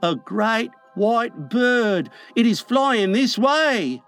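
slow, high wonder; hushless, high-pitched amazement; awestruck boy. A narrator's reading. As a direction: announce a marvel in boyish awe.

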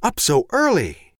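high, slow, sugary mother's voice; sudden sweet tone. A narrator's reading. Mother greeting her sleepy son, suddenly sweet. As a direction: switch instantly to sweet mommy talk. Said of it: "The change in her tone was so immediate".

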